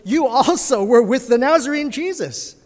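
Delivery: a servant girl pointing Peter out, lightly voiced; accusing servant girl.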